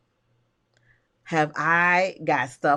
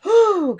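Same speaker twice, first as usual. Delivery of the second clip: exhaled whew; 13 semitones higher, 8 dB louder than usual. Real, not acted. Exhale before chatting.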